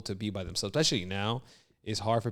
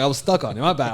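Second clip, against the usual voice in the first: loud admission; 3 semitones higher, 9 dB louder, faster than usual. Retold or imitated. Retold admission to partner.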